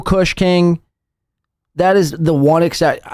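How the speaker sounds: ordinary delivery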